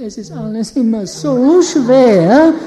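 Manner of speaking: hammed-up weepy complaint; whiny voice. Shown as a demonstration. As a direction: moan it like everything is a burden. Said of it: "die haben so eine weinerliche Stimme".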